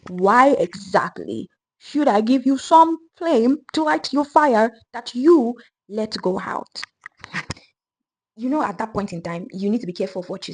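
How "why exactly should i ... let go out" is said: louder, slow, higher menacing question; demanding witch. Acted. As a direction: drag it out to intimidate.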